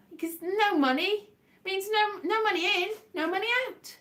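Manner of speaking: high sing-song mock cheer; mock relief. Real, not acted. To viewers, faking delight about having no income. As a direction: chirp it like it's great news.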